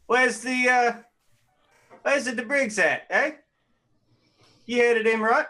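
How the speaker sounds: put-on high, hammy English accent; fake English accent. Player character asks a vendor for directions, mock English accent. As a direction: play a bad English tourist asking for directions. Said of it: "As an Englishman, I am deeply offended".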